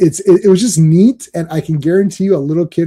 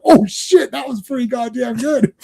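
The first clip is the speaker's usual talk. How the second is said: surprised delight; 7 semitones higher than usual. Retold or imitated.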